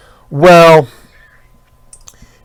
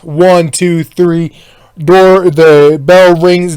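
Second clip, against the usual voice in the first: ordinary talk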